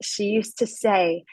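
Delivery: ordinary talk, nothing marked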